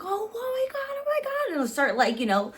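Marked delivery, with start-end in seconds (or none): 0.0-1.5 very high squeal; excited fangirl squeal; squealing friend. Retold or imitated. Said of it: "she's like".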